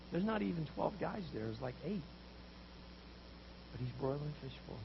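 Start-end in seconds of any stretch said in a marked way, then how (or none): none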